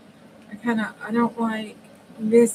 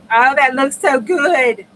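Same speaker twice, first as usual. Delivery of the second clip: delighted; 6 semitones higher, 12 dB louder, slower than usual. Real, not acted. Delighted at finished page.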